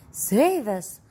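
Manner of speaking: high, loud cry for help; frightened cry. A narrator's reading. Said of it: "cried Grandpa Josephine".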